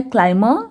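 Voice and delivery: क्लाइंबर बिना बी के, क्लाइ-मर; b को चुप रखकर क्लाइंबर बोलो; Climber with silent b. Shown as a demonstration. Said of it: "not climber". (बी silent के साथ क्लाइंबर)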